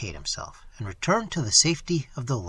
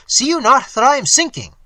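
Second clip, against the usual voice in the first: high, loud frantic plea; panicked plea; 11 semitones higher, 10 dB louder than usual. Acted. Moneylender sinking begs the creature, high loud panic. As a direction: beg in terror.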